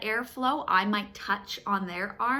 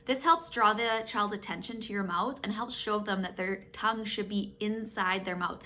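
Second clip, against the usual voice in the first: ordinary talk, nothing marked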